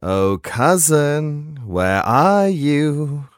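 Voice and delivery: slow, sing-song taunting call; a stalker calling sweetly to his prey; slasher killer. Acted.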